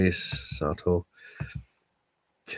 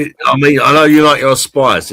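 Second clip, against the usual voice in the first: loud; 7 semitones higher, 9 dB louder than usual. Real, not acted. Loud teasing to friend.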